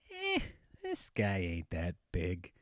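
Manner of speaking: slow, low, dismissive mumble; drunk bravado. Retold or imitated. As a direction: size him up, tipsy and unimpressed. Retold drunk sizing up of Tyson.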